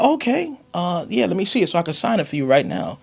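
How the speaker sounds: high, quick, loud mom voice; an eager mother rushing to sign; imitating mom. Mother asking her son for the test to sign, high and fast.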